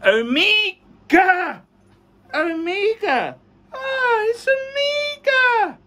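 high, drawn-out delight; excited high-pitched marvelling; thrilled reveal. Real, not acted. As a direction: savour the name in a soaring, slow cry. Thrilled reveal of the unboxed watch.

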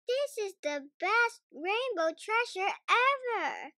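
put-on a child thrilled by a muddy puddle; delighted Peppa. A narrator's reading. Peppa tells her family about the puddle, high and delighted. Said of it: "says Peppa".

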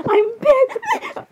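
high, laughing mimicry of the glitch voice; giggly mimicked Ben. Telling friends what he keeps saying, giggly mimicry. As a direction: quote him while giggling. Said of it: "He keeps saying".

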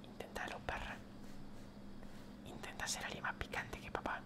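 very soft, voiceless whisper; whispered taunt. Real, not acted. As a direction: whisper a dare to your rival. Whispered taunt at game opponent. (susurro desafiante)

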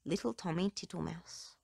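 usual speaking voice

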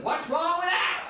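high, drawn-out mocking whine. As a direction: whine the catchphrase up high and slow. Repeated mocking catchphrase.

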